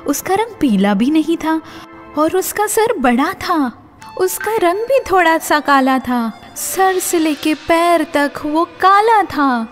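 plain reading voice

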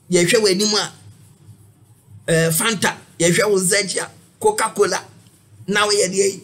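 high, loud mock sermon; imitating a prophet. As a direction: play the fiery prophet preaching.

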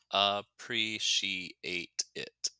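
"Appreciate it" slowly broken into five syllables. Shown as a demonstration. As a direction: sound out each syllable of "Appreciate it", slow and separate. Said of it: "is five syllables".

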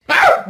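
sharp high loud scream; shriek of fright. Acted. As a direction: yelp as if startled.

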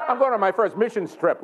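normal talking voice

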